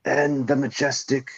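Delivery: lightly voiced Attenborough documentary narrator; Attenborough narration. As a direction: imitate a hushed British wildlife documentary narrator. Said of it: "you wanna hear David Attenborough".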